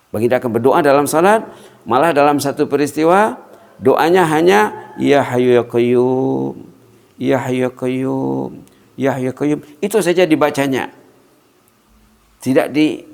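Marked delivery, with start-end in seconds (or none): 5.0-9.6 drawn-out chanted invocation; slow dhikr. Acted. Preacher chanting the Prophet's prayer, slow and devout. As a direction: stretch each name slowly, devotional.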